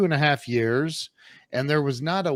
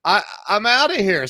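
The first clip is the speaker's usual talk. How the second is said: higher and louder, done with it; fed-up exit line; 8 semitones higher, 5 dB louder than usual. Retold or imitated. Quitting the factory job on the spot, raised and fed up. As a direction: fling it out like walking off the job. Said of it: "And I'm like".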